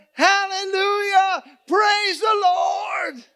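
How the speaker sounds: very high, very loud, drawn-out shout; long, belted hallelujah; uncontainable praise shout. Retold or imitated. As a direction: let the praise explode at full voice. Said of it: "She just couldn't take it anymore".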